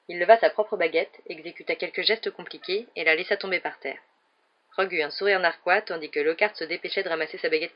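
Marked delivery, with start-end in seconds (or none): none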